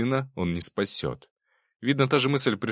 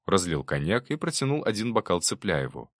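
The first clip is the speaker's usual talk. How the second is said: plain reading voice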